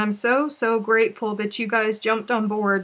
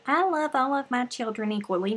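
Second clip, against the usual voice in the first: mock quote; 3 semitones higher than usual. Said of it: "That's what I'm saying".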